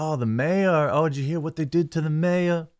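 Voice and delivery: a hint of a New York accent, gossiping about the mayor; mock New York accent. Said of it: "Is that a New York accent?".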